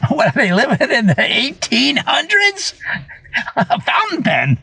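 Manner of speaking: cracking up, high, squeaky-laughing disbelief; real laughing disbelief. Real, not acted. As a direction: laugh helplessly through the words. Cracking up at absurd locksmith demand.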